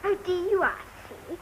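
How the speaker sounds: usual speaking voice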